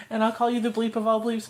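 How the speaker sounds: ordinary talk, nothing marked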